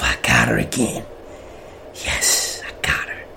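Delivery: quiet, whispered gloat of triumph. Retold or imitated. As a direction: whisper it, secretly pleased. Secret gloating to himself.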